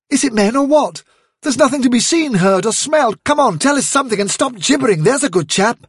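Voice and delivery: high, urgent, exasperated questioning; agitated demand. Acted. Hazel presses a gibbering Holly for news, agitated demand. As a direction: press impatiently for an answer.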